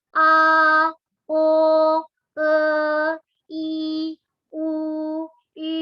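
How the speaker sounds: each vowel from "A" to "I" said slowly and clearly, with pauses; careful vowel demo; vowel drill. Shown as a demonstration. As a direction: spell the vowels out one by one. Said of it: "Aku ulangin".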